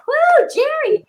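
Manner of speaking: high-pitched whooping shout-out; excited cheer. Real, not acted. Host greeting a viewer checking in from Southern Cal, excited cheer.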